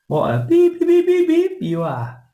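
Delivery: high squeaky "beep, beep, beep"; high silly bleeped phrase. Pretend. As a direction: ham it up like a censor bleep. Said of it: "do your good one then".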